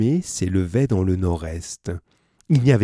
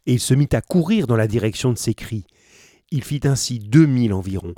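plain reading voice

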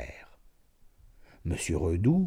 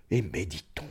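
voix basse; 4 semitones lower, 8 dB quieter than usual. A narrator's reading. Allongé sous le carcan, recueilli.

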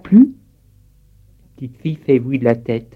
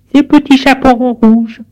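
put-on much higher, childlike answer; little girl voice; 12 semitones higher, 4 dB louder than usual. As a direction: sound like a small girl at the door. (voix de petite fille aiguë)

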